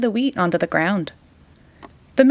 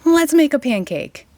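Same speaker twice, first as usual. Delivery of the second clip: higher, eager plea; eager boy; 7 semitones higher than usual. A narrator's reading. Jack begs mother for a pancake, eager plea. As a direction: push for the pancake, pitched up. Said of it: "shouted Jack".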